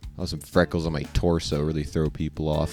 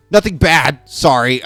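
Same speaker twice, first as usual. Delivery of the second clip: flustered man; 8 semitones higher, 9 dB louder than usual. Acted. Voices man nervously backpedalling.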